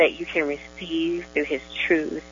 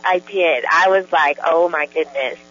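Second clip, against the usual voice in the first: joyful gushing with laughs breaking in; bubbling over, louder on the outbursts; real joy; 3 dB louder than usual. Real, not acted. Guest recounts her own experience to host, joyful. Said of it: "you can hear the joy in her voice".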